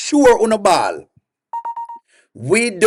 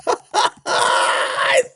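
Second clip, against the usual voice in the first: high, drawn-out cackle; gloating cackle; 4 dB quieter than usual. Real, not acted. Host warning rival fans, gloating cackle.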